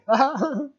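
a short high laugh; light laugh. Acted. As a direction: chuckle goodnight. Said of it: "en éclatant de rire".

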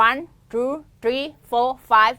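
very slow, spaced-out count; counting demo. Shown as a demonstration. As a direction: count one number at a time, with gaps. Shows child counting in order.

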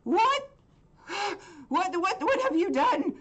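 high, loud shocked cry with a gasp; gasp, then blurt it out in disbelief; shocked outburst. Acted. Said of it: "and he's like".

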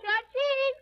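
high, loud shout of the name; loud high shout for Georgien. Acted. Calling out again, louder.

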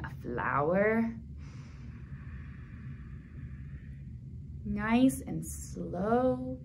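put-on gentle children's relaxation guide; guided breathing. Leads a breathing exercise.

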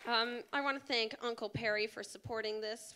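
ordinary delivery

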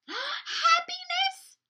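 high, drawn-out cry of delight; excited porcupine. Acted. Isabel dreams of balloons, gleeful high cry. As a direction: squeal the answer with joy. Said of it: "said Isabel".

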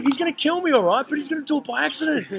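half-laughing mock terror; panicked. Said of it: "I was like".

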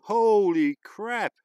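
loud, slow, drawn-out cry of wonder; amazed exclamation. Real, not acted. Amazed at the fallen tree.

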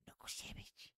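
put-on breathy little-girl whisper, no voice; whispered knuckle sandwich. Retelling a little girl's TikTok line, whispered. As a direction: hiss it like a tiny menace. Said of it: "like that".

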